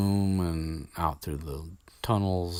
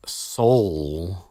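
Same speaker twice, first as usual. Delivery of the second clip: soul said with a breathy rising air-quote lilt; air-quoted soul; 6 dB louder than usual. Real, not acted. Mocking the word soul as he air-quotes it. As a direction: put scare quotes round soul with your voice. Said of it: "I'm air quoting real hard right now".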